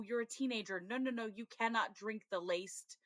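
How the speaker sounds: ordinary delivery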